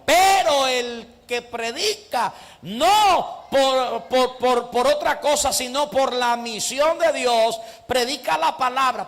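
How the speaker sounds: loud, high, slow preacher's cry; fervent preaching. Acted. Preacher rousing the church about true preaching, fervent loud cry. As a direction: preach it out with full fire.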